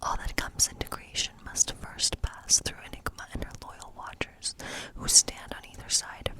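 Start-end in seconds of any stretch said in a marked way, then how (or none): none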